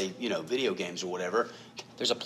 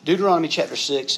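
calling out louder; 5 semitones higher, 7 dB louder than usual. Real, not acted. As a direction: announce Deuteronomy chapter six higher and louder. Repeating reference for congregation.

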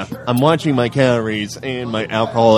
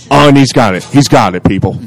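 louder play-by-play burst; mock-excited commentary; 6 dB louder than usual. Calling the mustard grab for listeners, mock-excited commentator. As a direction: punch it out like a winning shot.

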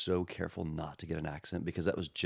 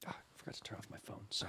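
very soft, whispered apology; hushed aside; 14 dB quieter, faster than usual. Real, not acted. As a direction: slip it in under your breath, whispering. Aside after phone interrupts recording.

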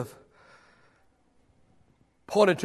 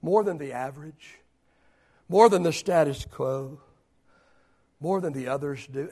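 much lower and softer, gentle probing; hushed Jesus; 5 semitones lower, 5 dB quieter than usual. Acted. Jesus quietly questioning Peter's devotion, very soft. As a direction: ask it quietly, letting it sink in. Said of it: "asks the question".

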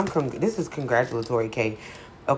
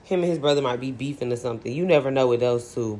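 usual speaking voice

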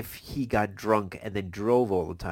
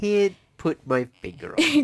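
mock puzzlement; 12 semitones higher, 5 dB louder than usual. Mock feigned ignorance.

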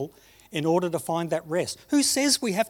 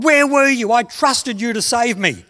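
a furious accusing shout, much higher and louder; 6 semitones higher, 8 dB louder than usual. Acted. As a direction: storm up to the throne and demand answers. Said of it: "he was filthy with God".

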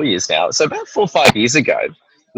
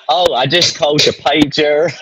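put-on slow, drawn-out Southern US accent; imitated Southern accent; 2 semitones higher, slower than usual. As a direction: playfully mimic a Southern American accent. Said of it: "trying to do a Southern accent".

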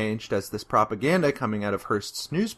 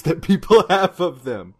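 usual speaking voice

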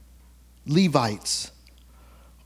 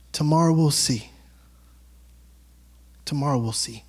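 low calm warning; 7 semitones lower than usual. Retold or imitated. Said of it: "and he just goes".

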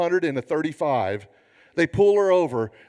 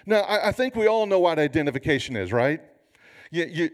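ordinary talk

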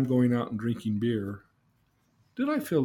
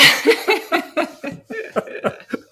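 high burst of laughter; 9 dB louder than usual. Real, not acted. Big laugh after mom answer.

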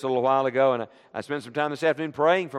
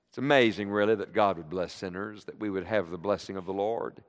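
softer, lower, in quiet awe; hushed awe; 8 semitones lower, 5 dB quieter than usual. Acted. Preacher marvelling to the church at God's blessing, hushed. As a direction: let it fall to a hushed wonder.

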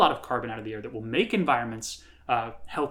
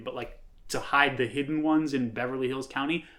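usual speaking voice

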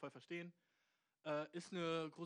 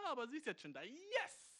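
high, louder burst of excitement; excited outburst; 9 semitones higher, 6 dB louder than usual. Real, not acted. Host finds girlfriend already downstairs, excited high yes. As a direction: leap up into a squealed yes. Girlfriend arrives early, must hurry.

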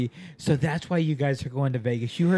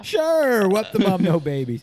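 a high, loud sing-song Vaughn impression; mock Vaughn; 6 semitones higher, 7 dB louder than usual. Host imitates Vaughn agreeing, high and loud. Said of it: "Vaughn is over here going like".

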